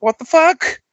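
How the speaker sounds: very high, baffled outburst; incredulous. Retold or imitated. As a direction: squeal it in disbelief. His retold baffled reaction.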